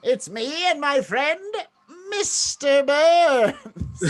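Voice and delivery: high, very slow nasal voice; high nasal voice, drawn out; nasal Lincoln voice. Pretend.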